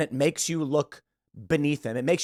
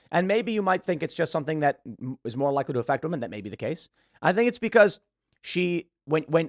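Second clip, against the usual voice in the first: ordinary talk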